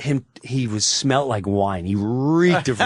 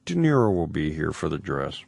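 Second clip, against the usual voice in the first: calm reassurance; 9 semitones lower, 4 dB quieter than usual. Retold or imitated. Producer calmly reassures.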